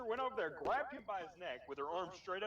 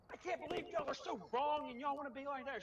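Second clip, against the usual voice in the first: higher-pitched imitation of an upset teacher; upset substitute; 6 semitones higher than usual. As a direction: raise the voice in mock indignation. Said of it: "She got all upset".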